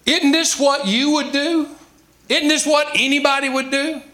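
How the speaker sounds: normal talking voice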